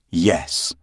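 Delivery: usual speaking voice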